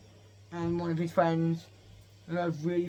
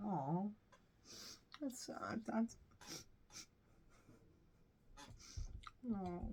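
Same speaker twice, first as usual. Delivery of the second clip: put-on very soft, sorrowful father-and-child voices; hushed pretend heartbreak; mock sad reunion; 12 dB quieter than usual. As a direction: murmur a hammed-up emotional apology. Acts tearful reunion with dad.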